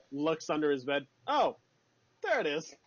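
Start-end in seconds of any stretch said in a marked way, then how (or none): 1.3-2.7 high-pitched "oh" of finding it; mock discovery. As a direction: play a lightly surprised find. Acting him finding the knife.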